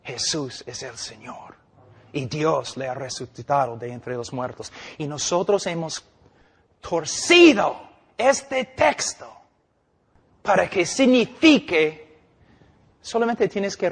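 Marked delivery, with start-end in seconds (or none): 0.0-1.5 quiet confession. Real, not acted. 6.8-9.3 high, slow, stretched out in scorn; scornful slow stress on "torcido este texto"; mocking emphasis. Real, not acted. Preacher mocking twisted doctrine.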